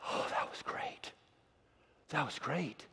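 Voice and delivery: hushed, breathless whisper; retelling John's first awed words, whispered. Retold or imitated. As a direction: whisper it like you can't believe it.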